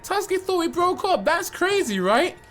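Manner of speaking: a high, falsely sweet girl voice mocking a rival; sweet girlfriend voice. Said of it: "she's like".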